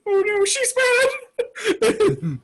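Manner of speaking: very high, drawn-out whimper; mock-tearful tiny creature voice; tiny storm cloud. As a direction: squeak it slowly like a frightened little cloud. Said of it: "a tiny little storm cloud".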